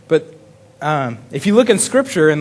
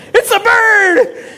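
high, slow, hammed-up shouts of wonder; mock overexcited shout; 4 dB louder, much slower than usual. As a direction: gush like the bird is a miracle. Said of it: "what are you so excited about".

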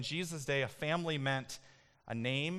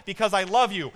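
loud and high, fervent declaration; preached loud; 6 semitones higher, 11 dB louder than usual. Acted. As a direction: proclaim it to the back of the room. Shouted answer in the sermon.

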